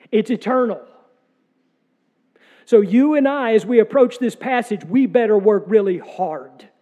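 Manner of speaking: forceful preaching. Acted. Pastor urging the congregation, loud forceful preaching. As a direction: proclaim it from the pulpit.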